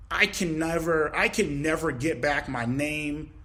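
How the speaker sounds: normal talking voice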